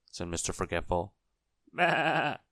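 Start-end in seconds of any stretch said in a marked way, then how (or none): 1.7-2.4 high, quavering baa; wobbly imitation of a sheep; sheep bleat. A narrator's reading. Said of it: "said the sheep".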